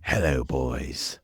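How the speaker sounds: low, slow villain impression; Crowley. TV fan greeting as a fictional wild card, low impression. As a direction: drop deep and draw it out. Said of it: "Crowley from Supernatural".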